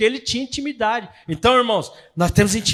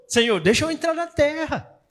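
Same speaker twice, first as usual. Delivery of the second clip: higher, imploring plea to God; a lightly voiced Moses begging; Moses pleading; 6 semitones higher than usual. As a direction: beg to be let in. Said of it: "Moisés implorou pra Deus".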